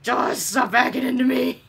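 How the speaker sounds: imitating an angry game character; mock angry. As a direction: play his irritation through your amusement. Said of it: "He's so angry. He's like".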